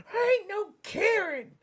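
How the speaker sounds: very high, squawking mimicry of an outraged woman; mock indignant comeback. Retold angry comeback from the woman.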